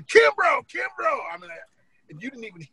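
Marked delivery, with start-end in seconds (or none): 0.0-1.2 high retold shout across the stadium; yelling a name. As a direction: call out a friend's name from the stands. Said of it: "I'm yelling".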